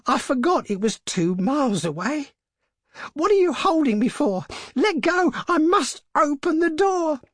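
put-on high, loud, struggling old woman; frantic crying out. A narrator's reading. Mother struggling to open the door, frantic cry. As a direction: build to a desperate high shout. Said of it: "she cried, struggling mechanically".

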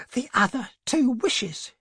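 high-pitched, eager urgency; urgent reply. A narrator's reading. Wife explaining her idea to husband, urgently. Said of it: "she replied rapidly".

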